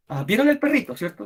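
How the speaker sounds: usual speaking voice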